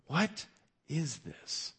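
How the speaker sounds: amazed question. Acted. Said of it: "they question among themselves".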